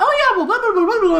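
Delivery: high singsong mock gabbing; chattering mother. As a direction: imitate mum rattling on with gossip. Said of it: "she'll just be like".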